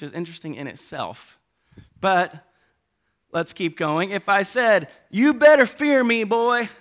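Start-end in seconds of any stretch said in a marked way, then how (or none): 5.1-6.7 loud, high Southern parent's warning; Southern accent. Acted. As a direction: warn a son in a Texas-style Southern accent. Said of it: "I would say it with a Southern accent".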